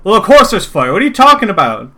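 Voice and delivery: high loud scolding calls; irritated workers. Acted. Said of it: "they're like".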